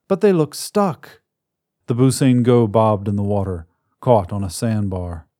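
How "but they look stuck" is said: put-on high, anxious little boy voice; a small boy fretting about the creatures; worried child. Soma worries the creatures are stuck, high anxious child.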